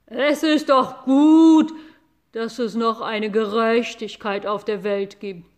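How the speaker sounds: loud, slow lowing cow voice; an angry cow crowing over justice; gloating cow. Acted. Cow gloats over the boy, loud slow lowing voice. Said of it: "brüllte Mairos".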